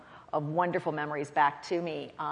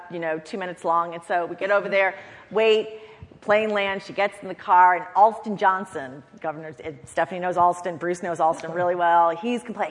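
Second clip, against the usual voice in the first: ordinary talk, nothing marked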